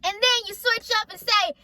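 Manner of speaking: high, indignant retold rant; mad all over again. Reliving the car argument with parents for viewers, mad all over again. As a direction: argue it out angrily, pitched well up. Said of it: "I'm getting mad all over again".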